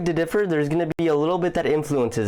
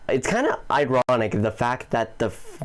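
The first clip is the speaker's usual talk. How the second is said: ordinary delivery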